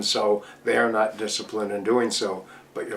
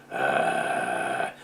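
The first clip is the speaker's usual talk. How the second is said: low creaky fry uh; airy rattly fry; 12 semitones lower, 5 dB quieter than usual. Shown as a demonstration. Teacher showing the signal of the fry, airy rattle. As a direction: breathe out a rattly uh. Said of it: "that airy, rattly fry".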